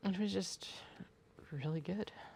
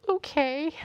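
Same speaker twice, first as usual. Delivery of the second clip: much higher, louder okay; incredulous okay; 13 semitones higher, 8 dB louder than usual. Retold or imitated. Reacting to the long-book warning, high and incredulous. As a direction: squeak it out in disbelief. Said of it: "And I'm like".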